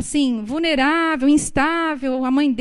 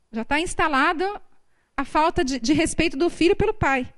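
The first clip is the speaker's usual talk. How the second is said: ordinary delivery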